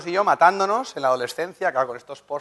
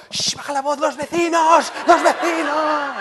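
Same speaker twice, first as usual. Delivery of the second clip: much higher mock mother shushing, rising to a shout; dark ominous voice; 13 semitones higher, 6 dB louder, slower than usual. Husband imitating his mother to his shouting wife. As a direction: channel mom's hushed panic about the neighbours. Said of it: "me conecto con una voz muy oscura, muy tenebrosa".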